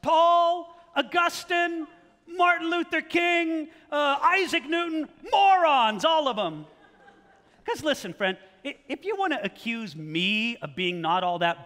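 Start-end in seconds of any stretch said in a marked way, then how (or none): 0.0-6.6 high, loud, sneering list of names; smug Vizzini voice. Retold or imitated. Preacher voicing arrogant critics as Vizzini, loud sneer. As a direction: roll off great names then dismiss them. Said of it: "It's like they're saying".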